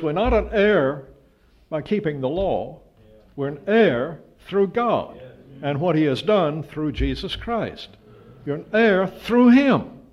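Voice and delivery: high, loud, slowed climax on "him"; emphatic preaching. Acted. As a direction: build to a ringing declaration. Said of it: "That's emphatic".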